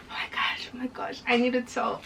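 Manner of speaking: hushed, urgent retold panic; panicked whisper. Panicking at work about telling a best friend, urgent whisper. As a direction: whisper it like you're bursting with a secret.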